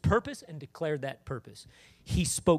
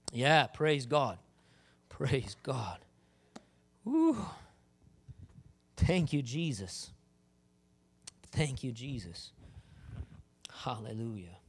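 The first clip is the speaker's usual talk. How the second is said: soft, slow praising; worship praise; 5 semitones lower, 4 dB quieter, slower than usual. Acted. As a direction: worship quietly, slowly. Worship after sermon.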